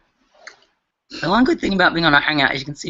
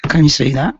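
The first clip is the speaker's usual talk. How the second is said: louder; 9 dB louder than usual. Real, not acted. Repeats question louder.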